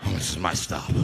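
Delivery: very low, subdued offender voice; back to senses. Acted. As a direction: drop deep, as if nothing happened. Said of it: "He came back to his senses".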